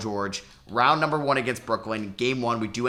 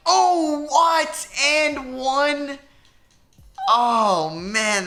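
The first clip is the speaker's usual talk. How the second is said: much higher, loud disbelief; shocked outcry; 13 semitones higher, 4 dB louder, much slower than usual. Real, not acted. Gamer stunned as Tatum scores an and-one, shocked yell. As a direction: yelp in disbelief at the call. Opponent scores and gets foul.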